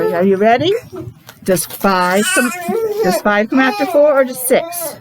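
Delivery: usual speaking voice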